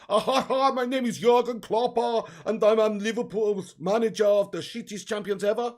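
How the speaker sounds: hammed-up Klopp with a laugh at the start; Jurgen Klopp accent. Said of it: "your Klopp accent is sick".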